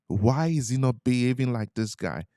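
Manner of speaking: ordinary talk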